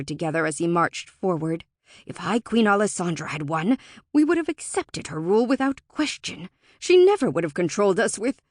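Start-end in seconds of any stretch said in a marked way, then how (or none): 2.0-8.4 heated, louder argument; indignant Fae. A narrator's reading. Indignant Fae argues with Alora over the lost queen, heated. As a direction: argue bitterly, breaking off at the end. Fae character's speech in narration.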